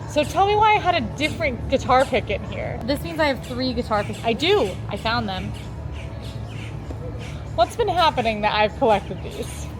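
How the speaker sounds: ordinary talk, nothing marked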